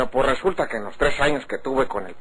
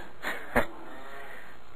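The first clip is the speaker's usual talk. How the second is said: breathy unvoiced chuckle; a short knowing laugh; quiet amused laugh; soft chuckle; 10 dB quieter than usual. [name] laughs at the question.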